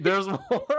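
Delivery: high confirmation through laughter; laughing. Real, not acted. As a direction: say it while cracking up.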